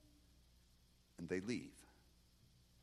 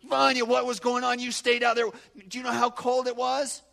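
amazed comrades; 12 semitones higher, 14 dB louder than usual. Retold or imitated. Comrades incredulous next day.

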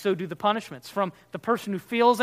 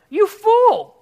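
insulting shout; 13 semitones higher, 13 dB louder than usual. Retold or imitated. Preacher voicing a man insulting his brother, shouted. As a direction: shout fool much higher and louder. Quoting an insult in Jesus' words.

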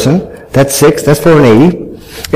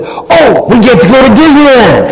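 put-on excited kids; 9 semitones higher than usual. Kids run up with news.

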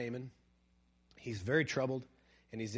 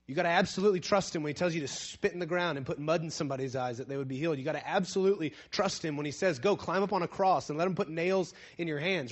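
louder, higher preaching push; fervent preaching; 5 semitones higher, 7 dB louder than usual. Real, not acted. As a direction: preach it with full force. Climactic preaching.

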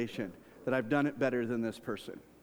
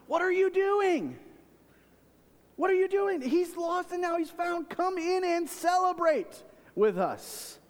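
much higher, slow, earnest fatherly plea; pleading father; 4 dB louder, slower than usual. Acted. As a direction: beg the son to come inside, voice lifted high. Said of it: "He came out to plead with his son".

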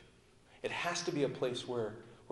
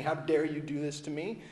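ordinary talk

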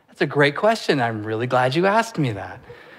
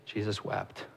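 much softer, low and hushed; 7 semitones lower, 16 dB quieter than usual. Real, not acted. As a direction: let it drop almost to a breath. Preacher quotes shortest verse hushed.

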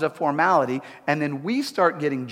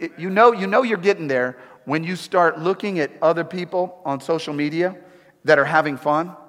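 normal talking voice

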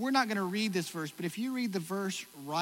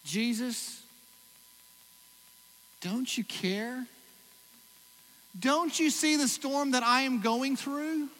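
pleading cry; 8 semitones higher, 5 dB louder, slower than usual. Retold or imitated. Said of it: "we shout at God".

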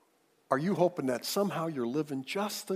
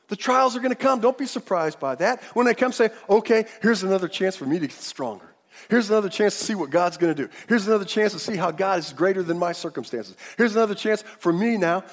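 full-force preaching; 6 semitones higher, 7 dB louder than usual. Acted. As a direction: preach it out to the whole church, stretching the big words.

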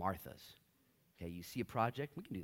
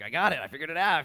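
high, loud triumphant; proud Martha; 7 semitones higher, 12 dB louder, faster than usual. Retold or imitated. A Martha boasting to the congregation, loud and proud. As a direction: crow that you figured it out.

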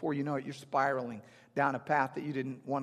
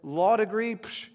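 cocky and dismissive of a law degree; a know-it-all friend's smug swagger; mock bravado; 4 dB louder than usual. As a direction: scoff at the need for a lawyer. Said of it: "somebody who says".

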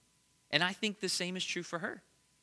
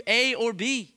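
rising, louder preaching, voice climbing; preaching at full force; 6 semitones higher, 5 dB louder than usual. Real, not acted. Preacher proclaiming God's power to the congregation, full force. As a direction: build the sermon to a ringing climax.

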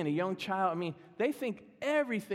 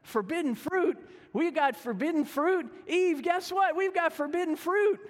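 high, loud, eager discovery; thrilled like a kid finding candy; excited Adam; 9 semitones higher, 4 dB louder than usual. Retold or imitated. Adam calling to Eve about the fruit, high and thrilled. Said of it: "Adam's like".

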